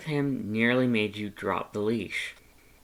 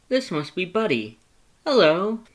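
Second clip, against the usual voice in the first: high, louder, rising hello; Papyrus greeting dog; 8 semitones higher, 7 dB louder than usual. Acted.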